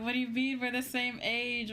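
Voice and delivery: ordinary talk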